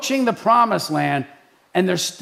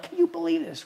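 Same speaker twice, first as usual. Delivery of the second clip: high, soft disbelief; incredulous; 10 semitones higher, 6 dB quieter, faster than usual. Acted. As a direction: play exasperated disbelief in a raised pitch. Voicing Moses and Aaron, incredulous.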